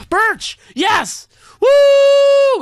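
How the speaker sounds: very high, loud shout of joy; triumphant shout. Real, not acted. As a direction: scream with victory. Finally gets birch sapling.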